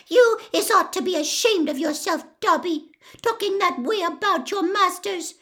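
high, indignant squeaky elf; Winky scolding. Acted. Winky shames Dobby for disloyalty, scolding squeak.

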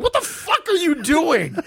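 high, slow, stunned imitation; mock shocked wife. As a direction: draw it out in high disbelief. Wife's shocked reaction quoted.

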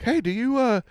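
imitating bosses, high and quick; high fast mock boss. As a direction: squeak it out like the boss. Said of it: "they're like".

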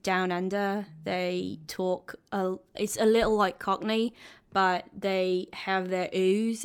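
drawn-out Australian accent demo. Shown as a demonstration. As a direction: show off an Australian accent, slowly. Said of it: "Give us your best accent".

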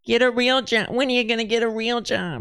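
hammed-up sitcom mom; nagging mom. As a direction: nag your grown son in a high mock-mom voice. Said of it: "his mom comes in, she's like".

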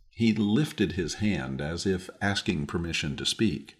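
usual speaking voice

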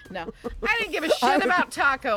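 loud, high blunt protest; exasperated outburst. Acted. Woman fed up with dead-dog talk, loud exasperated outburst. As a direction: burst out with it at high volume. Blurts out her real feeling.

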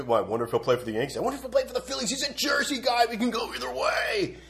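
high, sing-song parody of fan chatter; mocking speculating fans. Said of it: "we can stop talking about".

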